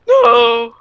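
high, loud drawn-out cry; wailed no. Pretend. Gamer crying out as his plane burns, wailing.

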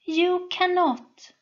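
high, slow smooth statement. A narrator's reading. Sol taunting the soldier, smooth and high. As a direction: glide it out, cool and certain. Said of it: "with a smooth voice".

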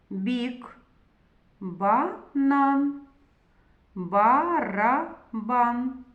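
drawn-out vowels, every syllable separated for learners; slow demonstration reading. Shown as a demonstration. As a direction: read each word slowly, syllable by syllable. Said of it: "Та прочитайте слова".

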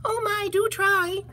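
very high, slow little voice; tiny fly. Acted. Fly urges the elephant to try, tiny high voice. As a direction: squeak it as a pleading fly. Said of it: "said the fly".

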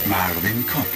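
ordinary talk, nothing marked

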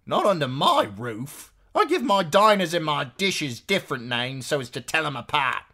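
high, loud, scolding woman; bossy innkeeper's wife. A narrator's reading. Innkeeper's wife ruling on names, high bossy voice. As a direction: lay down the law, loud and high.

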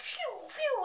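high whistling "phew"; quick cartoonish whizzing noise; imitated whoosh. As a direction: mimic something shooting past, high-pitched. Imitating pipes ripping through walls.

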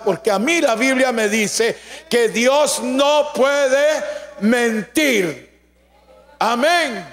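emphatic sermon with drawn-out key words; forceful preaching. Real, not acted. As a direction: drive each point home to the congregation.

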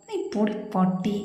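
soft muttered insult; muttered under breath. Acted. Younger brother muttering an insult at his brother, softly. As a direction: mutter it so the brother barely hears. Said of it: "പതിയെ പിറുപിറുത്തു".

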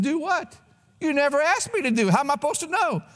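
high-pitched, indignant husband's retort; baffled protest. Retold or imitated. Husband answering wife's complaint that he didn't do it, high baffled protest. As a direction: plead ignorance in a high, incredulous voice. Acted quarrel with wife.

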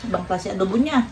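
ordinary talk, nothing marked

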